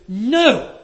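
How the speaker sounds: loud, high, sudden "No!"; sharp retold no. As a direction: snap the refusal out at the child. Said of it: "And I said to him".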